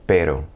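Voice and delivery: "pero" with one light tap; soft tapped R. Shown as a demonstration. Teacher gives another example, pero, tapped R. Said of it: "it tends to be that soft R".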